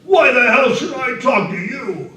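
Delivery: loud, high, rough-voiced bellow; roaring drunk. A narrator's reading. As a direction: roar it over the train noise. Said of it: "and roared above the".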